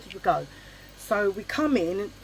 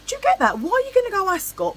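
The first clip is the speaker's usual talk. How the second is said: shoots way up in disbelief; can you believe it, pitch leaping high; incredulous; 13 semitones higher, 5 dB louder than usual. Real, not acted. Incredulous aside to viewers.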